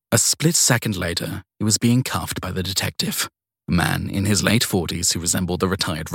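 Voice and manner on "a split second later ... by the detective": plain reading voice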